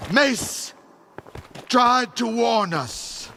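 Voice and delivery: ordinary talk, nothing marked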